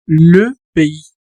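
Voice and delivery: "le pays" with a rounded "le" and "pé-i"; "le pays" with the S left silent; French le pays. Shown as a demonstration. Teacher modelling "le pays" for the class. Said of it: "la pronunciación es igual".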